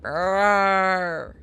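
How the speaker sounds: drawn-out Lurch-style arr; mock monster groan. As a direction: groan like a hulking butler. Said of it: "I instantly thought of, arr".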